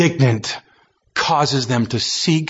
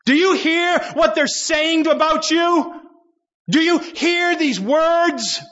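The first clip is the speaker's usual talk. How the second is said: much higher, sneering, slowing on the second question; high mocking challenge with a scornful laugh; angry accusation; 13 semitones higher, slower than usual. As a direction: jeer it at him from a bitter heart. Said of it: "out of an angry heart".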